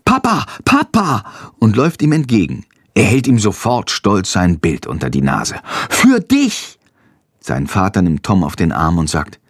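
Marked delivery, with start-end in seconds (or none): 0.0-1.2 put-on high-pitched excited boy's call; high, eager child's cry. A narrator's reading. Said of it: "Tom ruft". 5.9-6.8 put-on very high child voice; high-pitched, eager kid exclamation; proud little boy. A narrator's reading. Boy proudly hands father picture.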